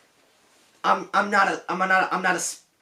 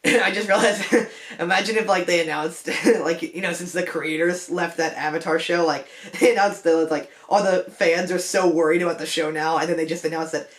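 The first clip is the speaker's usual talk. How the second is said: giggling through the words, lower voice; amused laughing; 3 semitones lower than usual. Real, not acted. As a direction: crack up while picturing it, laughs breaking in. Cracking up at an imagined announcement.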